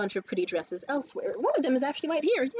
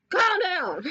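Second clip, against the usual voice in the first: higher, forceful mock telling-off; exasperated outburst; 7 semitones higher, 4 dB louder than usual. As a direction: burst out as if reining someone in. Repeats the telling-off more forcefully.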